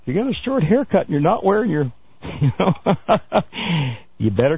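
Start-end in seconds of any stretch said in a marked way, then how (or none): 2.0-4.2 real laugh breaking through the words. Real, not acted. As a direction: crack up at your own joke. Joking about short haircuts.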